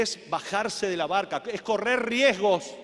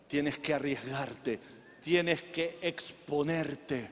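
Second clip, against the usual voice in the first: low, soft, spaced-out exhortation; hushed, measured pastoral charge; solemn preaching; 9 semitones lower, 6 dB quieter than usual. Real, not acted. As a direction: bring the church down to a sober appeal.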